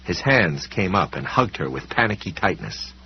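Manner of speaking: usual speaking voice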